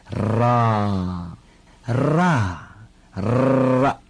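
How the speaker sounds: 啦 with a trilled tongue-tip r; rolled r. Shown as a demonstration. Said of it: "一块念".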